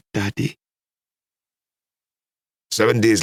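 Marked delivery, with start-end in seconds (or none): 0.1-0.6 higher, tearful plea; through tears. A narrator's reading. Daughter answers her father, tearful. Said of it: "I answered through my tears".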